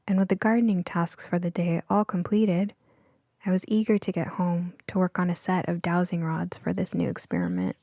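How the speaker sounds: ordinary delivery, nothing marked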